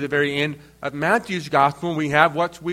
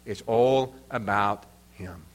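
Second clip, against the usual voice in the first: much lower and softer, It's all about him said almost under the breath; quiet; 9 semitones lower, 6 dB quieter than usual. Shown as a demonstration. Preacher quietly sets up the punchline for the congregation. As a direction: let the line drop low and hushed before it returns. Quoting pastor's hymn-book saying.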